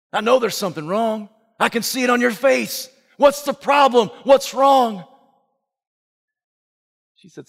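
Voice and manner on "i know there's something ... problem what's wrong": building from level to loud and higher; pressing son. Acted. Son demanding to know what is wrong, building loud. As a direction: push harder each question.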